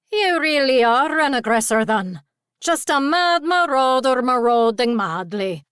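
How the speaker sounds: lilting, loud pixie taunt; high, playful sing-song tease. A narrator's reading. Pixie teasing his attacker, sing-song. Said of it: "said in a sing-song voice".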